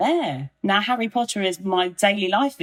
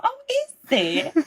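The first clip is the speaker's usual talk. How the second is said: much higher, slow Scottish McGonagall "Oh, is it?"; mock-modest Scottish professor accent; Maggie Smith McGonagall accent; slower than usual. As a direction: feign prim Scottish surprise, drawn out. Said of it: "the Maggie Smith accent".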